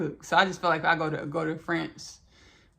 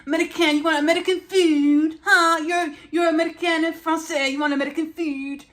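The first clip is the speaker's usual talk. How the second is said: high, loud mock French accent; hammed-up indignant Frenchman with a French accent; 10 semitones higher, 7 dB louder than usual. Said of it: "I wanna give y'all my French accent".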